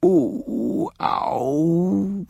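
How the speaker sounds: put-on high childish wail. A narrator's reading. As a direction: wail in protest. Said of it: "wailed Kipper".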